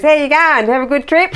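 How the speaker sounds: high, loud Australian greeting; broad Australian accent. Retold or imitated. Airport guy greeting a returning traveller, broad Australian accent. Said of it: "our accent sounded so obvious".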